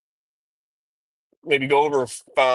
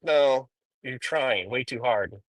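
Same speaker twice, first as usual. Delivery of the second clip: normal talking voice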